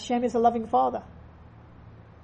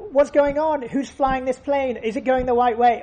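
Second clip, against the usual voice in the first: raised, louder, anxious questioning; panicked passenger; 2 semitones higher, 6 dB louder than usual. Retold or imitated. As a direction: fire off the worried questions at the stewardess. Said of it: "and says".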